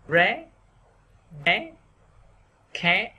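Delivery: "ré, bé, khé" each swept upward, separated; rising sắc tone. Shown as a demonstration. Teacher drilling stressed syllables for learners, rising tone. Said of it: "đọc thêm dấu sắc".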